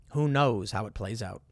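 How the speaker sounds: ordinary talk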